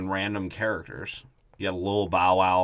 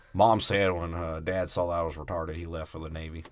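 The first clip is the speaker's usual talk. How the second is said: put-on Bama boy; 5 semitones lower, 6 dB quieter than usual. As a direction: play a subdued Southern country boy. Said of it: "Bama boy".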